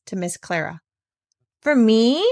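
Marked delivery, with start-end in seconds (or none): 1.6-2.2 louder, surprised little question; as if she can't believe it's for her; astonished. A narrator's reading. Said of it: "said Clara in astonishment".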